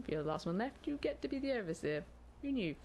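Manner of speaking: usual speaking voice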